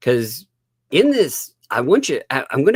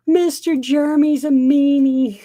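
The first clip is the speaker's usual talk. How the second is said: high whiny crybaby voice; mock crying; 13 semitones higher, 4 dB louder than usual. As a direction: wail it like a sulking child, mocking him.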